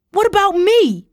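high, furious shout; booming. A narrator's reading. Tara demands her uncle think of her, booming shout. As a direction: boom it out, voice way up. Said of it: "Tara boomed".

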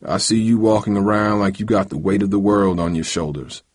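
plain reading voice

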